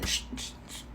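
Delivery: whispered shushing, breath only; hushing whisper. Real, not acted. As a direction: hush her so the cameras can't hear. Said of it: "Shh, don't say that out loud".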